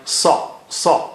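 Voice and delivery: sa said thick and high, close to the English saw; heavy sa. Shown as a demonstration. Teacher modelling the thick sa for learners. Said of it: "very thick and high".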